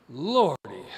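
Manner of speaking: a loud burst of Lordy caught up in the moment; excited exclamation. Real, not acted. Preacher carried away by his own sermon, exclaiming loudly. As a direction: let Lordy break out loud as the fervor peaks. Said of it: "getting all excited here".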